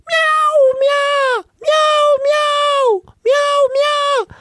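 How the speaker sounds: very high shrill meows; cat crying out. Acted. Cat trapped in the washing machine, crying meows. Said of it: "il se met à pousser de grands cris".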